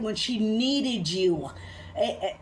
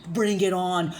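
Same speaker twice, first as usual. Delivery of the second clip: normal talking voice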